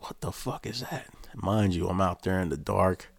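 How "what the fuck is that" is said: high, hushed alarm at the shadow; alarmed quote. Retold or imitated. Guard alone at night, hushed alarmed question. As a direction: breathe it out, higher and softer. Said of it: "I'm like".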